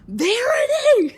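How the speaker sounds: high, loud, drawn-out shout of the name, breaking into laughter; panicked shout. Retold or imitated. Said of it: "and she's like".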